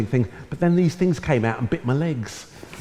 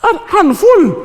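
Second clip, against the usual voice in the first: loud, high, drawn-out mock yell; exasperated mum; 7 dB louder, slower than usual. Mum answering her son's repeated question, exasperated. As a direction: belt it slowly as an exasperated mum. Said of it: "She said".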